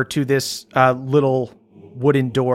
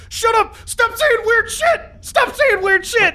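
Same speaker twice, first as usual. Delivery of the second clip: very high, squealing yell; panicked angry yell. Acted. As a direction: shriek it at her to silence her. Guy snaps at Grez.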